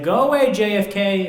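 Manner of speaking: high, loud comeback; retort. Retold or imitated. Fat kid answering the bully Bobby, loud mock retort. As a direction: fire the comeback back at him. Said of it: "I was like".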